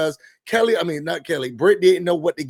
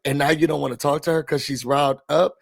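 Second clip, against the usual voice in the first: usual speaking voice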